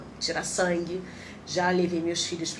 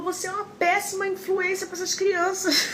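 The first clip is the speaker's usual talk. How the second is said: high, scolding nurse voice; 13 semitones higher than usual. Retold or imitated. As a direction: tell her off like an exasperated nurse. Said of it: "ela falou".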